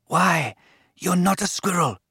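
high, voiced murmur of realisation; a touch of hushed astonishment; whispered wonder. A narrator's reading. Anand realises what the animal is, mildly awed. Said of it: "he whispered".

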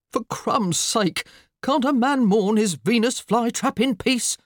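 exasperated muttering. A narrator's reading. Harried husband mourning his flytrap, exasperated. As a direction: grumble it with rising exasperation. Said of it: "Mister McCrumpet muttered".

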